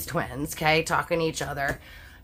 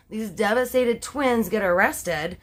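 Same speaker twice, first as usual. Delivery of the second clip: normal talking voice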